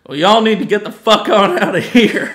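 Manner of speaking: loud mock Southern accent. Mocking a dubbed anime line, loud Southern accent. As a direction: ham up a country twang, loud. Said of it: "recorded all of the accents".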